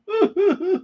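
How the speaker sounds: high jeering laugh; put-on derisive laugh; mocking laugh. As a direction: cackle at the stupid Americans. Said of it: "They're probably laughing at us right now".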